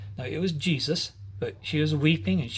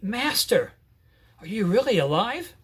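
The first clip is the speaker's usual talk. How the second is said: joyful disbelief; 6 semitones higher than usual. Acted. Mary recognizes the risen Jesus.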